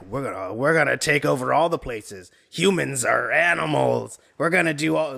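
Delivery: lightly voiced British empire, barely shifted; a hint of an imperial Britain boasting; mock Britain voice.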